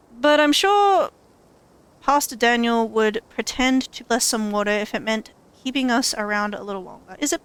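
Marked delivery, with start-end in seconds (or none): none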